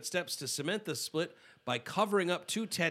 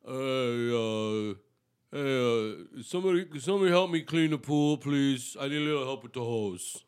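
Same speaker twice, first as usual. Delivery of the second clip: imitating Stallone. Voicing Stallone asking pool help.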